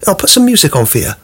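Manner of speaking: mock eager teen accepting the treats; playing along nicely. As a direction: go along with the niceness. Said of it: "I'll go".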